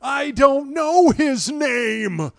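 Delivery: high, loud, slow dazed line; Jeff Bridges impression. Host doing his limited Jeff Bridges, high, slow and dazed. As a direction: drag it out like a stunned father. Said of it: "That is my limited Jeff Bridges".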